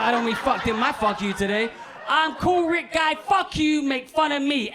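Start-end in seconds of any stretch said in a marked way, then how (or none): 2.1-4.7 high, slow mock rage; mock furious. Catfished man rebuking his mocker, high mock fury. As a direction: draw out the indignation, pitched up. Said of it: "he's furious".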